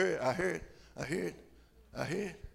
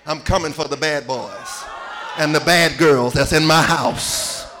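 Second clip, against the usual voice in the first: loud, booming voice of the Lord; 6 semitones lower, 8 dB louder than usual. Retold or imitated. Preacher voicing the Lord's warning to the congregation, booming. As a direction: boom it out as God warning sinners. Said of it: "I heard the Lord say".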